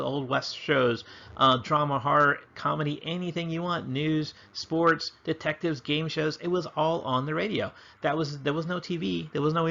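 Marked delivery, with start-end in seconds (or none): none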